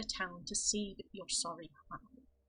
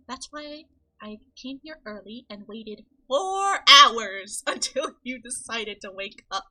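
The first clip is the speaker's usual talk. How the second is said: louder, slowing down, laughing through it; hammed-up grumbling at the sleeper; a loud laughing complaint; mock annoyed rant; 2 semitones higher, 16 dB louder than usual. Character scolds late wake-up.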